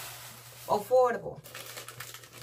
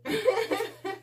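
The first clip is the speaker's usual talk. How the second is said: a high, bright laugh at herself; 11 semitones higher than usual. Real, not acted. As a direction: laugh at your own silliness, high.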